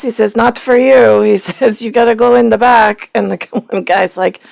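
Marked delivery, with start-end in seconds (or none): 0.4-1.2 higher, louder, showy lemur king voice; snooty movie lemur. Retold or imitated. Quoting King Julian from Madagascar. 1.8-3.1 higher, louder, showy lemur king voice; snooty movie lemur. Retold or imitated. Quoting King Julian from Madagascar.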